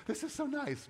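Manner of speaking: high, gushing fake delight; mock gushing. Pastor imitating people reading greeting cards, high and gushing. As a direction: play the shallow card reader. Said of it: "read on the cards".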